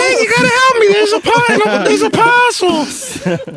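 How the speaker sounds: put-on high, crying wail of a dying child; faint deathbed whisper. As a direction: whimper it out very high and slow.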